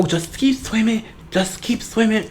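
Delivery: high, soft, slow cartoon voice; sing-song it like a cheerful forgetful fish; Dory impression. Quoting Nemo character line.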